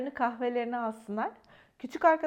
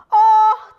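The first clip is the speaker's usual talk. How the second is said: sudden shrill ow, much louder; startled pained cry; loud high yelp of pain; 9 semitones higher, 18 dB louder than usual. Acted. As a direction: yelp as a fox gets bitten. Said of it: "dedi biri".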